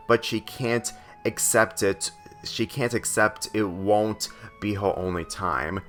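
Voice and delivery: ordinary delivery, nothing marked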